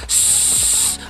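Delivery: hushing shh. Retold or imitated. Enemy hushing behind tree.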